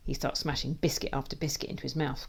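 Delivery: ordinary delivery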